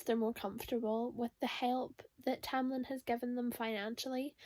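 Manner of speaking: ordinary delivery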